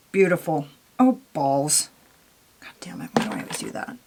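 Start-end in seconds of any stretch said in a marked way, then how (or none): 2.6-4.0 hushed frustrated whisper. Real, not acted. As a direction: breathe it out, annoyed. Frustrated muttering at a mistake.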